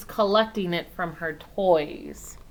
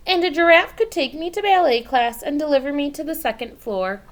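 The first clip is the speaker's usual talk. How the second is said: put-on eager child; 7 semitones higher, 6 dB louder than usual. Child voiced eagerly arguing.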